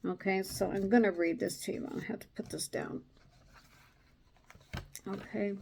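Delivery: normal talking voice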